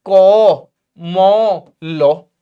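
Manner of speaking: loud Bihari spelling-out with long 'o'; को, मो, लो drawn out in a Bihari accent; Bihar tone. Shown as a demonstration. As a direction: sound out each letter Bihari-style, rounded and stretched. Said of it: "Bihar की tone में देखूं".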